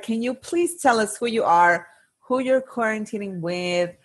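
ordinary talk

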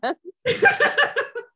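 high squealing laugh. Real, not acted. Podcast host cracking up at the joke: high laugh. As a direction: let out a squeaky giggle.

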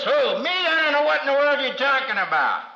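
high, fast frantic denial; a scared disciple denies his master, high and quick; Peter denying. Retold or imitated. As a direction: panicky Peter brushing it off. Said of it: "Who said that?".

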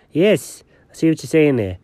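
normal talking voice

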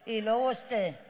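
lightly voiced grieving guy; teary [name].

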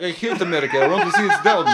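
loud, high hammed-up Russian accent; drunk Russian accent. Drunk spy letting her Russian accent out, loud and hammed-up. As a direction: blurt it in a mock Russian accent. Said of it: "her Russian accent would come out".

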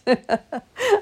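high giggle after a tease; high laugh. Real, not acted. Laughing at her own joke to viewers, high giggle.

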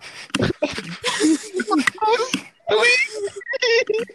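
high, loud helpless laughing fit; shrieking laughter. Real, not acted. Storyteller cracking up at his friends' antics, shrieking laughter.

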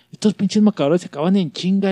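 lightly hammed-up teacher grumble; mock grumbling teacher. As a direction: gripe about the markers like the teacher. Teacher voiced complaining.

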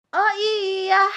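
put-on high, loud child's sudden realisation; excited shout. A narrator's reading. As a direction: exclaim it like a princess with an idea. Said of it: "teriak Putri Kecil".

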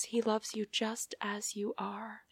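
soft whisper, breathless with feeling; hushed quote from Bridget Jones. Retold or imitated. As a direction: whisper it like a secret confession. Quoting Bridget Jones tenderly.

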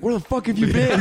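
high, laughing fake outrage; hammed-up demand, cracking up; mock angry customer. Pretending to yell at the late delivery guy, laughing.

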